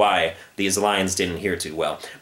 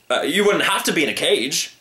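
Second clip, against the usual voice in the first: shouting; 6 semitones higher than usual. Acted. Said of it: "shouted the circus man".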